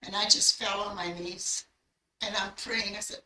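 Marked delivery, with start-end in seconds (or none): none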